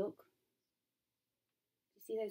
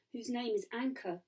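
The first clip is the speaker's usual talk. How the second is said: ordinary delivery, nothing marked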